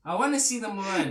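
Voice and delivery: amused Jersey voice with a laugh; Jersey guy, laughing; Jersey accent. Retold or imitated. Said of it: "is that your Jersey accent?".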